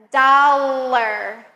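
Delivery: slow, clear words with the L in the middle; slow model words. Shown as a demonstration. Teacher models middle-L words for repetition, slowly. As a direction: stretch each word so the middle L is heard. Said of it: "let's try some other words that have L in the".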